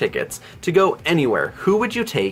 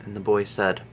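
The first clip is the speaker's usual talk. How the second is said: spooky bedtime story; 8 dB quieter than usual. Acted. Reading viewers a bedtime story in the dark, hushed and spooky. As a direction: tell it like a ghost story by candlelight.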